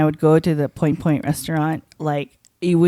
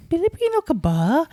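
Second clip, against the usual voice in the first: put-on high-pitched Tagalog question in a Filipino accent; 9 semitones higher than usual. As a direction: imitate asking in a Filipino accent. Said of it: "I spoke in an accent".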